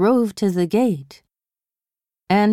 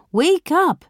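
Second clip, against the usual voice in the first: calling out; 9 semitones higher than usual. Acted. Joe rousing sleeping girl.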